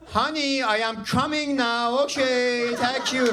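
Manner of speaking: hammed-up bedroom talk in a thick Persian accent. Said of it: "If I say it like that".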